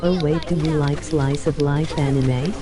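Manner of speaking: normal talking voice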